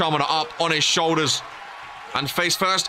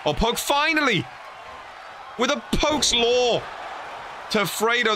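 excited call; 7 semitones higher than usual. Real, not acted. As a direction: erupt as the big move lands. Commentator erupts at finishing move.

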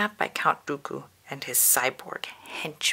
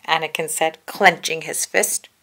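usual speaking voice

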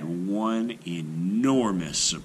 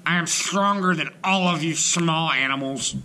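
hippo boast; 9 semitones higher, 4 dB louder than usual. A narrator's reading.

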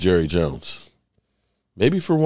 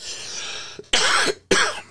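soft choking cough fit; 4 dB quieter than usual. Real, not acted. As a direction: cough through the choke, quieter. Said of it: "sorry, I'm choking here".

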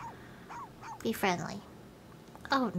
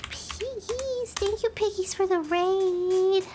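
squeaky, raised grateful shout-out; high excited thanks; 7 semitones higher, slower than usual. Real, not acted. Streamer thanking the raiding viewers, high and excited. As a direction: beam it out high.

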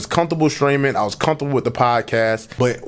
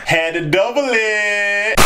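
drawn-out boast; 7 semitones higher, much slower than usual. Acted.

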